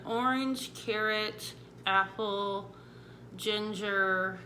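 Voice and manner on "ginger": ordinary delivery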